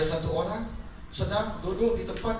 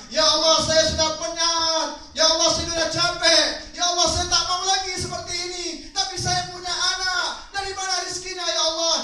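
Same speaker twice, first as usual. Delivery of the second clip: higher, pleading cry to God; desperate plea; 7 semitones higher than usual. Acted. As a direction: beg for help, worn out. Exhausted woman cries out to God. (rintihan putus asa, suara tinggi)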